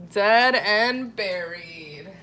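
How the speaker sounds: slow, stretched-out DEAD AND BURIED; drawn-out repeat. Pretend. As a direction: draw each word out for comic emphasis. Repeats the joke for emphasis.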